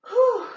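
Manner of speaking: high sharp whew of relief; winded exhale. Real, not acted. Instructor to class after jumps, winded whew. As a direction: puff out hard after the set.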